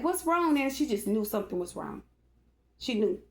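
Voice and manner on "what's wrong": higher worried question; worried niece. Retold or imitated. Niece asking her crying aunt what is wrong, higher. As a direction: ask like you sense trouble. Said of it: "She was like".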